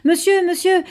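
out of breath, calling out high; breathless cry. Acted. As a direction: cry out, panting from running. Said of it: "crie-t-il encore tout essoufflé".

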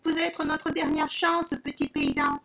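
pleading. Acted. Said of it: "supplie le Pandore".

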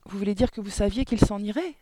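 loud, high, incredulous question; shocked exclamation. A narrator's reading. Girl learning her teacher suspected it, shocked exclamation. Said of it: "s'exclama Mathilda".

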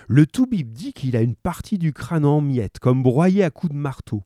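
usual speaking voice

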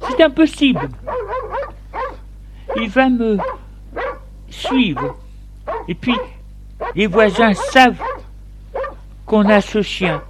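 storyteller's high voice for a worried wife; protesting wife. A narrator's reading. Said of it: "Elle lui dit".

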